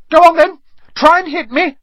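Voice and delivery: very high, loud dare; defiant challenge. A narrator's reading. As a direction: goad them into a fight in a high-pitched shout. Raising his fists.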